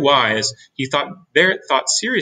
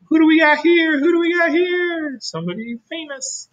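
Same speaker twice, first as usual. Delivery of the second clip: cooing fan voice. Acted. Cooing over a star.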